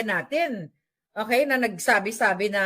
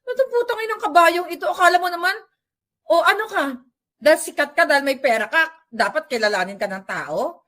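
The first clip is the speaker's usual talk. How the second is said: higher and louder indignant tirade; angry rant; 5 semitones higher, 3 dB louder than usual. Retold or imitated. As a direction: tell the arrogant star off. Imagined rant at the celebrity.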